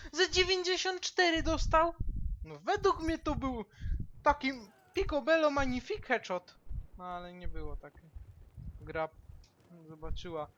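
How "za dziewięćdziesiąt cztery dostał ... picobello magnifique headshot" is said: high loud indignant yelling; outraged shout. Real, not acted. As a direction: protest in disbelief. Outraged at missed kill.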